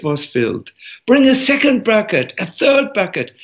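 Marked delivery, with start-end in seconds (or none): 1.1-3.3 high, mounting storyteller's commands; urgent orders. Acted. Teacher demands more buckets for endless yogurt, urgent.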